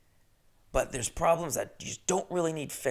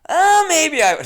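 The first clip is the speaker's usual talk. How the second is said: much higher and louder joke voice, laughing through it; put-on landlady voice; 12 dB louder than usual. Host jokes about doing the landlady's voice, laughing put-on voice. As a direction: tease that you'll do her voice after all. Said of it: "but I'm not gonna do that voice".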